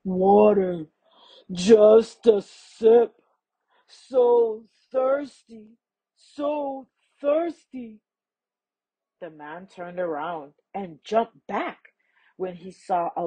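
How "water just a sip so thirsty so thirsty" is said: slow, drawn-out creature voice; thirsty crocodile. A narrator's reading. Thirsty crocodile begging a man for water, slow and drawn out. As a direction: drag each word out, parched and pleading. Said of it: "he heard a deep voice".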